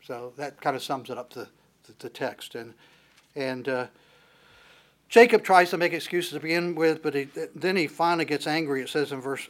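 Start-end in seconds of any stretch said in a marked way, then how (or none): none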